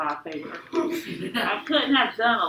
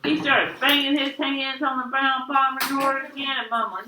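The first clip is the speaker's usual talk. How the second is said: ordinary delivery, nothing marked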